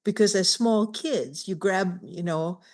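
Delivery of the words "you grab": ordinary talk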